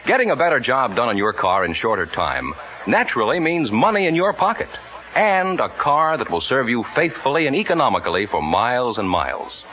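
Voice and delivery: normal talking voice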